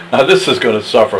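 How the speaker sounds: usual speaking voice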